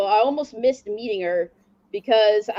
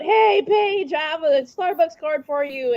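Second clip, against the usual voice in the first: high, raised call to get attention; mock shout, pitched up; retold shout; 6 semitones higher than usual. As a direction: holler it out like calling across a line. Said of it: "I shouted out".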